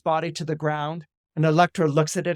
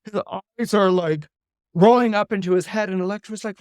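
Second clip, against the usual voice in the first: plain reading voice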